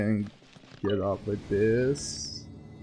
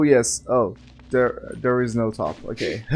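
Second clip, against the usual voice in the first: normal talking voice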